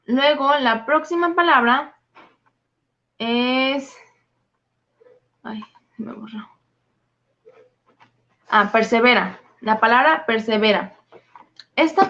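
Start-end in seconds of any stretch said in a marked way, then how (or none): 5.4-6.5 whispered aside. Real, not acted. Her notes got erased mid-lesson.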